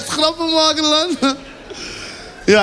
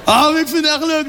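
usual speaking voice

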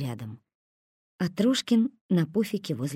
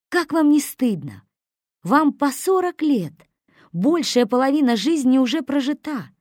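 reproachful lecture; 7 semitones higher, 6 dB louder than usual. A narrator's reading. Трошкин lectures them.

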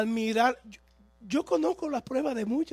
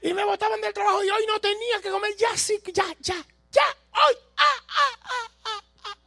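rising frantic outburst; 12 semitones higher, 6 dB louder than usual. Acted. Acted panic, building cries.

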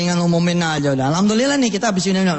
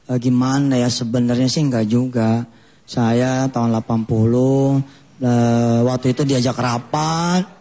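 put-on hesitant old man; 6 semitones lower, slower than usual. Old man confessing softly.

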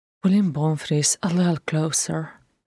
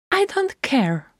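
a high, sharp retort; the singer snaps at her sister, sharp and high; 10 semitones higher than usual. A narrator's reading. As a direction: snap it at her. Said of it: "Baris said sharply".

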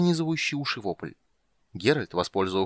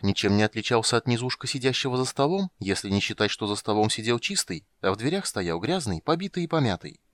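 plain reading voice

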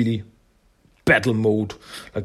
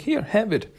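high, bright offer to share; friendly Mikey offer; 10 semitones higher than usual. Retold or imitated. Mikey offering the donut to Tokka, high and friendly. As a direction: hand it over up high and sweet.

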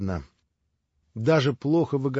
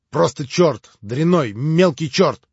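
louder, higher, spitting out the insults; contemptuous outburst; 5 semitones higher, 8 dB louder, slower than usual. Acted. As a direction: build scorn into a shout. Ivan raging about the devil. (презрительно, с нарастающей злостью)

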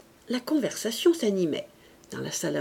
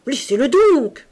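loud, high angry cry; shouting; 6 semitones higher, 11 dB louder than usual. A narrator's reading. Father crying out at his daughters, loud and high. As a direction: snap at his daughters to stop. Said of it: "cria-t-il".

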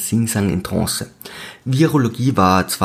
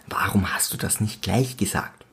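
whisper; 6 dB quieter than usual. Acted. Narrator whispers aside.

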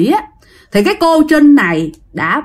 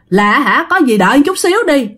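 ordinary delivery

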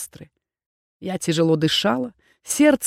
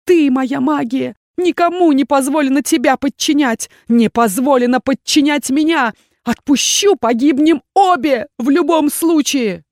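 desperate outcry; 5 semitones higher, 5 dB louder than usual. A narrator's reading. Desperate struggle in the vortex.

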